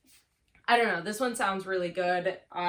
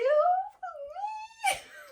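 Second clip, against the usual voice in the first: high squeal laugh; 5 dB louder than usual. Real, not acted. Reacts to own question.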